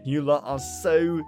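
usual speaking voice

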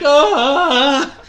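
loud laughing yelp up high; mock panicked yelp. Teller mimics his mid-air yelp, laughing. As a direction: let out a half-laughing cry mid-air. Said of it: "And I'm like".

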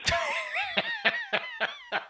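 high, hammed-up tycoon laugh fading off; exaggerated high-pitched laugh in Branson's voice; mock laugh. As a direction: cackle as the gloating Branson. Branson laughing after demand.

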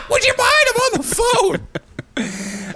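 high, slow, prissy mock voice; mock woman. Imitating the woman on the phone in a slow high voice. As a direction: draw it out high like a haughty driver.

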